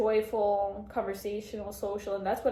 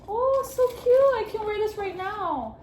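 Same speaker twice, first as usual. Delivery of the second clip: delighted squeal; 12 semitones higher, 8 dB louder than usual. Real, not acted. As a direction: squeal with joy at the gift.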